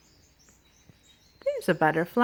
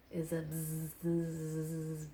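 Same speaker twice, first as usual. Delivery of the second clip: drawn-out bee buzz; 5 dB quieter, much slower than usual. Acted. Teacher showing the children a bee card, buzzing.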